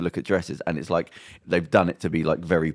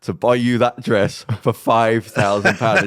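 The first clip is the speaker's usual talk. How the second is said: slow, loud mock-incredulous question; acted pointed question; 3 semitones higher, 7 dB louder than usual. Man challenging girlfriend over dress price, slow pointed question. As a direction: spell it out slowly and forcefully.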